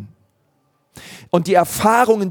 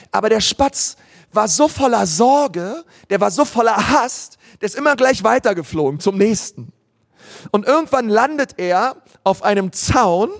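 bright, lilting story voice a little higher; storytelling to children; 4 semitones higher than usual. Acted. Pastor retells a children's book to the congregation, storytelling lilt. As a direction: tell it like a bedtime story.

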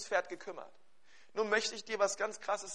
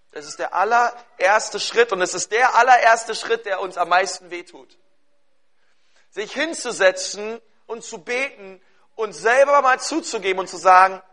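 loud, emphatic pulpit delivery; urgent, raised sermon voice; forceful preaching; 4 semitones higher, 16 dB louder than usual. Real, not acted. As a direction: punch out each point at full volume.

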